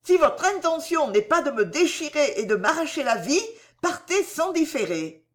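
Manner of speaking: high, urgent female character voice; sharp exclamation. A narrator's reading. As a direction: order him away, alarmed and angry. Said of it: "s'écria vivement".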